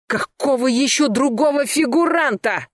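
high-pitched indignant yell. A narrator's reading. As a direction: bellow it, losing control. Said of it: "заорал Денис Астахов". (заорал, выходя из себя)

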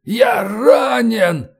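high, very slow, strained cry; agonised wail of an injured man; wounded, muffled reply. A narrator's reading. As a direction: drag the words out in pain. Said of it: "глухо ответил Роллинсон".

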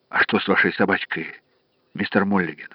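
soft Irish-free vet question; quiet. Acted. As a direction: ask it gently and low. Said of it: "Я загремел во весь голос".

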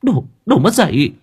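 high, tearful insult; near tears. Acted. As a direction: spit it out close to crying. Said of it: "giọng như muốn khóc". (giọng cao, nghẹn ngào như sắp khóc)

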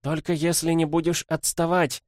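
put-on high-pitched girl's call. A narrator's reading. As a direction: call back to a follower, high. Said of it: "крикнул голос Цзиньвэй". (высокий окрик девочки)

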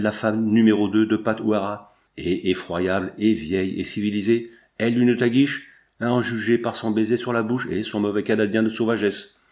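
ordinary delivery

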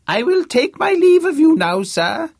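put-on very high, loud shout of a grand old don. Fake Vice-Chancellor bidding Harvey farewell, loud shout. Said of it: "shouted James".

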